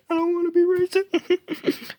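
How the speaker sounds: very high squeaky falsetto plea; mock whiny plea in a squeaky voice; silly falsetto. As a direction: squeak it like a cartoon.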